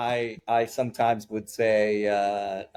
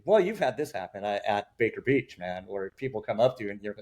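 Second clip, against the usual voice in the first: ordinary delivery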